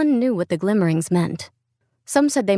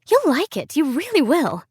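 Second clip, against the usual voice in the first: much higher, bright coaxing friend voice; eager friend; 8 semitones higher than usual. Acted. Friend urging Leela to see the play, much higher. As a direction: sell her on the play, higher.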